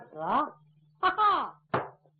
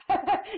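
laugh; 7 semitones higher than usual. Acted. Laughs in pleading voice.

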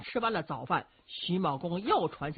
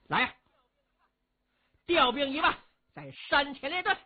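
firm military order, lifted in pitch; commanding general; 7 semitones higher, 3 dB louder than usual. A narrator's reading. General orders troops to line up before the mountain, commanding.

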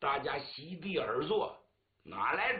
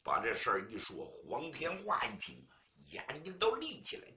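ordinary delivery, nothing marked

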